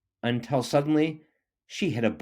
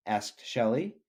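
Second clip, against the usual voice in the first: ordinary delivery, nothing marked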